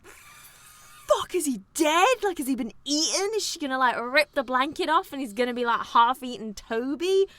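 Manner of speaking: high-pitched alarmed questions, easing down; mock panic, starting high; acted alarm. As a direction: fire off worried questions as a horrified viewer. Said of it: "and you're like".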